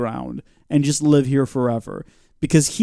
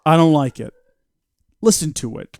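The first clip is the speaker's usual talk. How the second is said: normal talking voice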